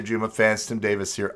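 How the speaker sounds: ordinary talk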